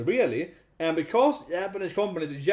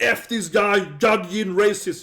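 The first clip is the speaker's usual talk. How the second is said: mock angry lawyers; 4 semitones higher, 6 dB louder, slower than usual. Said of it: "like fifty lawyers being like".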